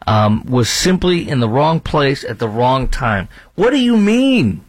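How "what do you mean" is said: outraged. Real, not acted. Outraged at reporter's phrasing.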